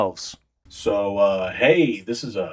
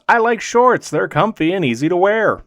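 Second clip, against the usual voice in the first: higher, louder, proudly declaring it; proud trainer boast; 5 semitones higher, 4 dB louder than usual. Acted. Trainer boasting proudly to the player about shorts. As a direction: puff up and announce your love of shorts. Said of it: "this guy who proudly proclaims".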